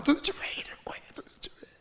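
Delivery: hushed, whispered protest; pent-up resentment. Acted. Preacher voicing resentment toward God, in a whispered outburst. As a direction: whisper it with indignation held inside. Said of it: "하나님께 막 울분이 나오는".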